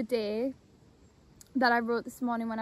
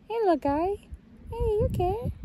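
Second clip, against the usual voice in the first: cooing to a critter; 9 semitones higher, 4 dB louder than usual. Real, not acted. Greeting a small animal.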